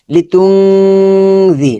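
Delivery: slow drawn-out nasal ghunnah on তুংজি; Quran recitation. Shown as a demonstration. Teacher demonstrating tajweed, slow chanted ghunnah. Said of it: "লি তুন থেকে হয়ে যাবে তুংজি".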